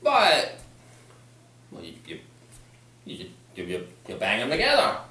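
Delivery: ordinary talk, nothing marked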